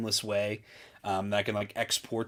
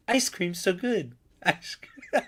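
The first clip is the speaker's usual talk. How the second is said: high-pitched mock NPC catchphrase; TikTok NPC streamer; 8 semitones higher, slower than usual. Mocking livestream brain rot, high NPC voice. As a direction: parrot it high, then crack up.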